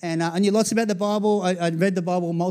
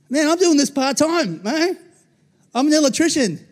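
mock complaint; 7 semitones higher, 4 dB louder than usual. Preacher acting a scared believer whining under fire. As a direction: whine like an out-of-depth part-timer.

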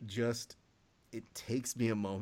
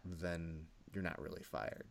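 usual speaking voice

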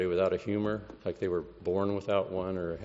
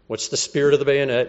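shouted drill call, louder; drill sergeant yell; 5 semitones higher, 8 dB louder than usual. Retold or imitated. Sergeant yelling at recruits, loud drill shout. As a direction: bark it out like a drill sergeant on the field. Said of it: "he'd yell out things like".

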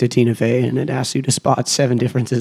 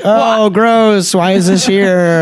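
grossed out; 8 semitones higher, 4 dB louder, much slower than usual. Retold or imitated. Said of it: "they keep being like".

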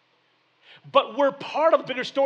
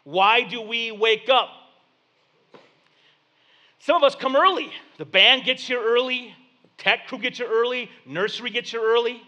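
louder, rising sermon delivery; preaching; 3 semitones higher, 4 dB louder than usual. Real, not acted. Pastor preaching to his congregation, louder and building.

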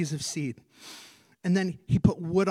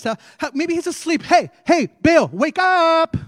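mocking shout; 10 semitones higher, 10 dB louder than usual. Elijah taunts the prophets, shouting at Baal to wake. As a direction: yell at a sleeping idol to wake up. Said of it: "Elijah sitting here going".

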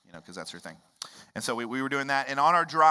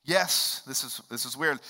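ordinary talk, nothing marked

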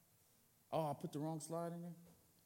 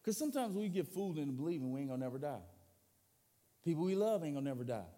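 ordinary talk

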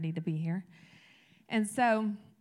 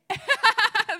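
laugh; 10 dB louder than usual. Real, not acted. Audience answer gets laugh.

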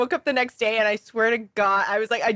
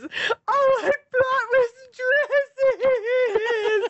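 very high, very slow, sob-laughing lament; laughing-crying wail; 12 semitones higher, much slower than usual. Retold or imitated. Friend retelling the injured woman's lament, a high slow laughing sob. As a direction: wail it through tears and laughter. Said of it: "the next thing she said".

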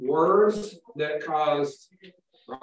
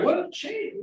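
surprised question; 12 semitones higher than usual. Retold or imitated. Rajan asks the changed man.

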